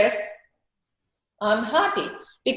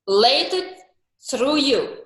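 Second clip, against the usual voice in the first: usual speaking voice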